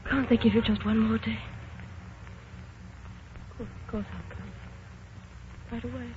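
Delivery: shocked bride hearing the wedding is off, hushed; stunned and hushed. Acted. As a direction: take the blow quietly. Phone call with bad news.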